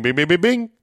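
ding out the bells like a jackpot; slot machine bells. Retold or imitated. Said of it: "ring you up as winning".

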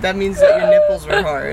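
squealing high with laughter; laughing. Real, not acted. Guest explaining slang while cracking up, very high. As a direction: laugh through it, very high and loud.

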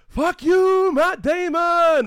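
high, loud shout, last words stretched; over-the-top indignant yell; oversold heckle. Retold or imitated. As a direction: bellow it at the cinema screen. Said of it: "butchered the delivery trying to oversell it".

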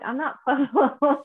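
laughing reply. Retold or imitated. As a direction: retell the comeback while cracking up. Laughs through own retold reply.

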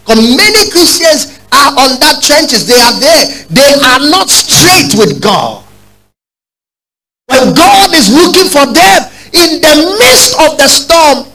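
high, slow, ringing sermon cry; impassioned preaching. Acted. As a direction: preach it high and drawn out to the church. Preaching at full force.

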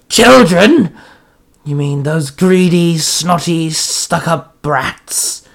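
sneering villain, loud outburst then drawn-out contempt; Jack Frost. Acted. Monster mocks the plea for the children, sneering. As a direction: explode on the first word, then savour each insult. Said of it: "Jack Frost sneered".

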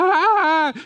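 high mock scream like a girl; comic high-pitched retold scream; girlish scream. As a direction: shriek "ah" high, imitating a startled girl. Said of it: "I screamed like a girl".

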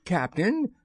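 high-pitched, eager sidekick. A narrator's reading. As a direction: pipe up eagerly to the boss. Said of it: "by Smee's eager voice".

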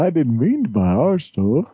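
a hint of [name]'s Scouse; [name] impression. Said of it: "instead of [name]".

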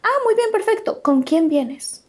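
a lightly voiced receptionist. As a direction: play the brisk front-desk girl. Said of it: "te preguntan".

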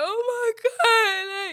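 high, slow, wailing cry; anguished cry, pitch high and stretched. Retold or imitated. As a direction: break into a sobbing "oh my God". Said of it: "so I start crying immediately".

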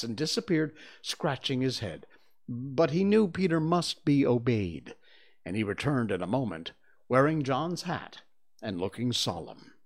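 usual speaking voice

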